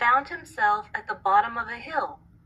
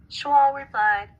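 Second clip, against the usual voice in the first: usual speaking voice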